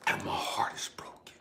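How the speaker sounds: very soft, near-whispered sorrow; hushed heartbreak. Retold or imitated. Father watching his children from above, hushed grief. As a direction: let it drop to a hush, heartbroken.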